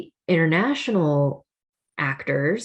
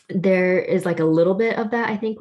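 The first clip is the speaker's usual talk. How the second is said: ordinary talk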